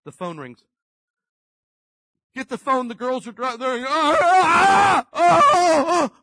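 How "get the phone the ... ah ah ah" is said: screaming high and loud in panic; frantic yelling. Retold or imitated. Caller shouting a panicked emergency down the phone. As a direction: shriek the alarm like a crisis.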